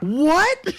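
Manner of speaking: high, loud disbelieving what; shocked what. Retold or imitated. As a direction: squeak it in total shock. Clerk shocked at news.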